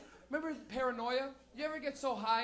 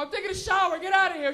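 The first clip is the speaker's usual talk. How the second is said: defensive yell; 7 semitones higher, 10 dB louder than usual. Retold or imitated. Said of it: "I go".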